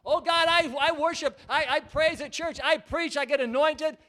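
loud, high eager protest; eager believer. Acted. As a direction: insist proudly to God. Believer boasting to God.

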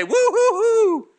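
high, drawn-out mock whoop; mock cheer. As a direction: whoop like a complacent, overjoyed churchgoer. Mocks complacent churchgoers cheering.

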